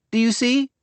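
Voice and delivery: put-on child spotting the turn; young girl pointing. A narrator's reading. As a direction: point it out eagerly. Abigail spots the road.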